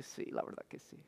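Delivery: whispered, hushed admission; half-whispered as the tired wife; sheepish whisper. Retold or imitated. Wife's sheepish answer, retold.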